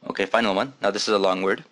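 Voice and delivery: ordinary talk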